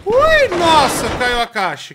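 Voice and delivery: sudden high, loud squeal; startled cry then calming; startled yelp. Real, not acted. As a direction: jump at the falling box. Startled by falling box.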